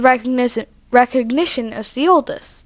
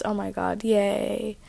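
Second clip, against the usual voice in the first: plain reading voice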